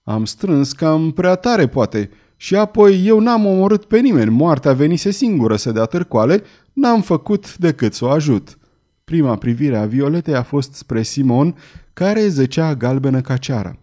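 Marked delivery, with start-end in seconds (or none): none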